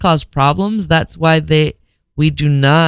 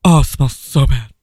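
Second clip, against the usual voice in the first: playing a sickened pilot, mock disgust. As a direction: groan at the stink, half-retching.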